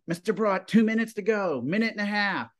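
high urgent calling of time left; students' countdown call. Retold or imitated. Students warn teacher of airtime countdown, high urgent calling. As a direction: call out the countdown like an anxious kid.

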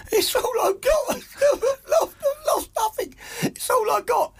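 high, wailing, sobbing soap-opera cry; Ian from EastEnders. Retold or imitated. Mimicking Ian sobbing on EastEnders, high and wailing. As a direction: ham up Ian's tearful breakdown, way up high. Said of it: "Ian will be going".

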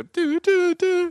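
high sung doo doo beep; call end beep. Shown as a demonstration. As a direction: demo a phone hang-up tone. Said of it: "Just go".

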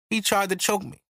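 plain reading voice

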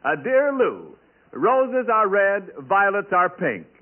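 normal talking voice

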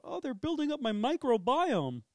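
high, loud, prissy mock voice of a smug dog owner; mimicking dog owners, high and loud. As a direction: parody the owner who defends the face licking. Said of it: "constantly talking about".

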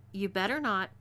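usual speaking voice

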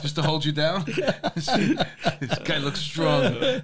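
giggling while joking about him being held down; laughing. Real, not acted. Host laughing while teasing guest about the exam.

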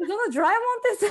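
ドラえもん with a j-like dr, through laughter; laughing English dr. Retold or imitated. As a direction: retell the slip laughing, English dr. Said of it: "みたいな". (笑いながら英語風の「ドラえもん」)

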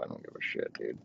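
under-breath whisper; whispered aside. Real, not acted. Comic aside on his receiver pick, whispered. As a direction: toss it off in a whisper to himself.

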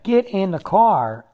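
slow, loud, insistent order; urgent command. Retold or imitated. Cuban driver ordering him into the car, forceful and slow. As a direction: bark it at the stranded tourist.

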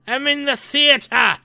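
high, slow mock phone talker; rude moviegoer. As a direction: imitate a clueless guy on the phone. Mimics rude phone call.

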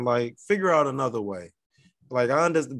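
usual speaking voice

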